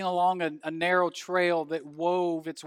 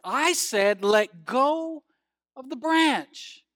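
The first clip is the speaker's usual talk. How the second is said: raised, louder insistent command, drawn out at the end; insistent repeat; 6 semitones higher, 5 dB louder, much slower than usual. Acted. Voice from the cliff repeating its order, insistent. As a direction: insist on it, stretching the final words. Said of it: "The voice said once more".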